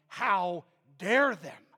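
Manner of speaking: slow, loud mock outrage; hammed-up affronted protest; imagined indignation. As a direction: act out righteous indignation, drawn out. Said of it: "she's going to say something like".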